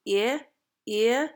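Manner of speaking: short I of kit tightened toward ee-uh; tighter forward vowel. Shown as a demonstration. Coach showing the accent's changed kit vowel, tighter and forward. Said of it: "a little tighter sound".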